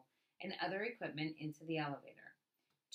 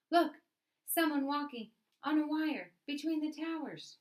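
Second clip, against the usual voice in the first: higher and louder, pointing up in amazement; astonished call; 9 semitones higher, 7 dB louder than usual. Acted. Woman spots the walker, calls out.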